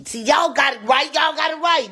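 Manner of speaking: high, loud shout of praise; excited cheer. Real, not acted. Streamer cheering viewers who guessed right, excited shout. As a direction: cheer them on for getting it.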